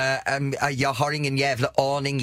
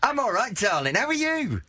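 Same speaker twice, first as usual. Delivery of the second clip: mock British accent; 7 semitones higher than usual. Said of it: "han byter dialekt ungefär var trettonde sekund".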